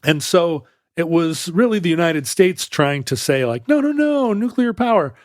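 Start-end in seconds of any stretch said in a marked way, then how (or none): 3.7-5.1 much higher, mock-insistent quote; high-pitched imitated protest. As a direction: lift the voice high to act out a sales pitch. Voices the United States reassuring others.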